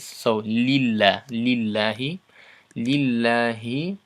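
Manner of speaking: lillahi slow with the final i clearly pulled toward E; slow kasra demo; stretched E demo. Shown as a demonstration. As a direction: lean on the E of lillahi. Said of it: "as close as you can get to that sound E".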